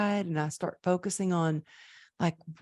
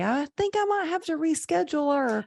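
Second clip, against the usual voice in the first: "I think I might have to reschedule" pitched way up, playful; much higher, far-off voice, mock innocent understatement; high mock self-quote; 12 semitones higher than usual. Retelling her call to client.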